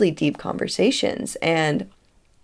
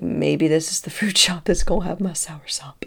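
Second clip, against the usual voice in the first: hushed hopeful whisper to herself. Retold or imitated. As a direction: whisper the wish under her breath. Hoping shop has soursop.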